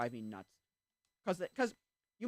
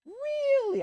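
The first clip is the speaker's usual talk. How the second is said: high, loud incredulous "Really?"; mock-shocked mother squeaking in disbelief; imitating a stunned mother; baffled squeal; 12 dB louder than usual.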